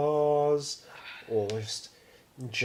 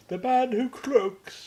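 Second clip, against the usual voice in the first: slow, high put-on voice; mock recognition; 8 semitones higher, slower than usual. Naming the pub's croaky regular, slow mock recognition. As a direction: draw it out in a raised character voice.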